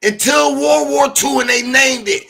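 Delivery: high, drawn-out shout of protest; heated shout. Real, not acted. Panelist shouting over a heated debate, high and slow.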